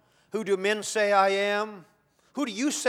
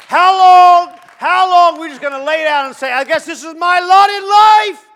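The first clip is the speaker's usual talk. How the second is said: a preacher's soaring, shouted challenge to the church; preaching at full force; 11 semitones higher, 16 dB louder than usual. Acted. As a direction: throw it at the congregation, high and loud.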